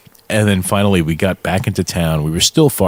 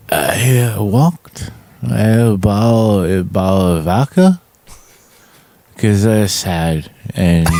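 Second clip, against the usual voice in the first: put-on slow, halting sad-drunk act; hammed-up sorry drunk; much slower than usual. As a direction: drag the words out as a fake sad drunk. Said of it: "I just started the act".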